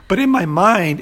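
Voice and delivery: ordinary talk, nothing marked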